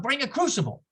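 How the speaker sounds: ordinary talk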